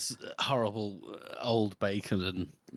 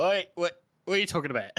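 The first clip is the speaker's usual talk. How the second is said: pushy oi; 8 semitones higher, 4 dB louder, faster than usual. Retold or imitated. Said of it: "walk up and go".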